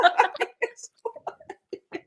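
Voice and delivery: silent high giggle. Real, not acted. Laughing at own story.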